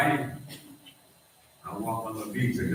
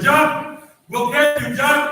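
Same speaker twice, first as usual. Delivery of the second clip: high, loud urgent shout of jump; yelling father; 10 semitones higher, 5 dB louder, slower than usual. Retold or imitated. Father shouting up to his trapped children, loud urgent yell. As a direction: call up through the burning house.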